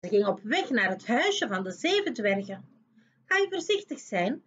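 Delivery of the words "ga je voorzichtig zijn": higher, caring little voices; dwarfs' warning. A narrator's reading. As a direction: dwarfs warning before work. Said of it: "vroegen de dwergen".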